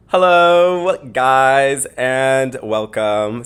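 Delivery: big podcast welcome. Real, not acted. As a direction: throw open the show like a radio host.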